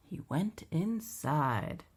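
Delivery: plain reading voice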